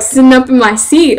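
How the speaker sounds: ordinary talk, nothing marked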